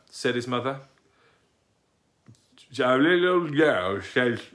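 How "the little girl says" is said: loud, slow, muffled mumbling through a mouthful; mouth full. A narrator's reading. Young owl talking to his mother with a beakful of sock, mouth full. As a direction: force the words out round a stuffed mouth. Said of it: "with a fat mouthful of sock".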